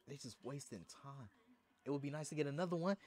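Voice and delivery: ordinary talk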